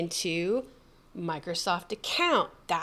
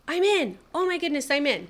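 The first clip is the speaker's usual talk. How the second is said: excited; 5 semitones higher than usual. Real, not acted. Relief at getting in.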